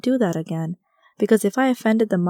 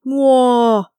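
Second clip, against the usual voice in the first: loud cow-like bellow, higher and louder; mooing rhino roar; 5 semitones higher, 8 dB louder than usual. Retold or imitated. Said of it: "made an aggravated sound".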